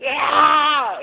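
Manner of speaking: high frustrated yell into the air; frustrated cry. Retold or imitated. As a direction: let out a mock angry shout. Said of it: "And you just go".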